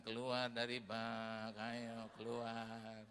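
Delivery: slow firm order out of the tub; slow, low no-nonsense command to get out; stern shooing. Retold or imitated. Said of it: "orang tuanya nggak ada".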